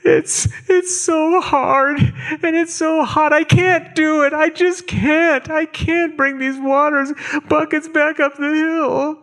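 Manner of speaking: put-on high, slow whimpering child; high little girl begging, close to tears. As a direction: whine like a tired, hot eight-year-old girl. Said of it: "she says".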